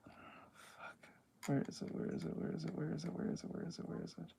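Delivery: hushed muttering while searching; whispering. Real, not acted. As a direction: whisper it to yourself, over and over. Searching quietly for a clip.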